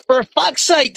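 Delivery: high-pitched copy of a startled catchphrase; imitated exasperated outburst. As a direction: mimic a friend catching a slip mid-talk. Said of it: "And you was like".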